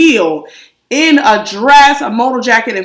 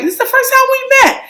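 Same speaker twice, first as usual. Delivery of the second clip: mock sweet greeting; 4 semitones higher, faster than usual. Imitating ideal polite greeting.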